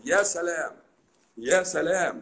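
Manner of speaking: high mocking ya salam, drawn out; sarcastic wow. Real, not acted. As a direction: scoff at the doctors' big words. Sarcastic mock at doctors' chemistry. (يا سلام بسخرية عالية)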